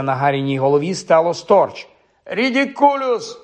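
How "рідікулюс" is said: high, stretched-out cry; shouted spell. A narrator's reading. Pupil casts at the wailing banshee, high drawn-out cry. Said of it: "вигукнув Шеймус".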